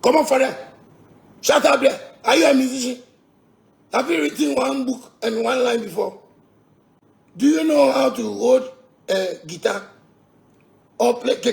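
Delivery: heated yelling. Real, not acted. Ranting at a critic, mocking.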